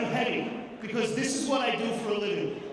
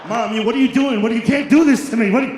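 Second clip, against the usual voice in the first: put-on loud panicked kid's protest; 11 dB louder, faster than usual. Boy in corduroys yelling at his mom, loud panicked protest. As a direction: yell at mom in childish outrage.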